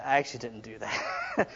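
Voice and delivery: ordinary talk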